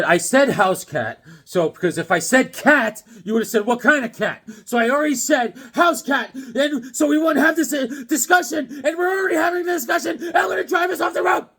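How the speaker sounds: builds from loud to high, fast shouting; exasperated rant. Retold or imitated. Husband exasperated at wife's questions while driving, building shout. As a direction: lose patience and shout it all out. Retold exasperated argument with wife.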